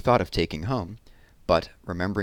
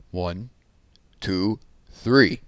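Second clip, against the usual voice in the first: counting out loud; much slower than usual. Acted. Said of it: "he said out loud".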